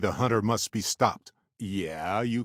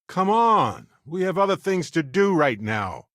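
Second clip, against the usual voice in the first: louder, higher push to get moving; impatient urging; 8 semitones higher, 4 dB louder than usual. Acted. Prodding the captain onward, loud impatient call. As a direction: hurry them along, exasperated.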